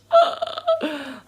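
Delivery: loud, high, delighted gasp with a laugh; excited gasp. Real, not acted. Vlogger gasping over a YouTuber's pregnancy, delighted gasp. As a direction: gasp with joy.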